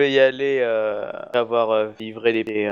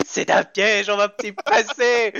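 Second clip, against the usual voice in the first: mocking paranoid players; 7 semitones higher, much slower than usual. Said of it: "tout le monde dit".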